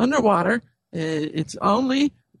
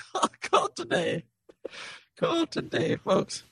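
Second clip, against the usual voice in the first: infomercial pitch, higher and softer; home shopping host; 7 semitones higher, 5 dB quieter than usual. Acted. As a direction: sell it like a TV pitchman.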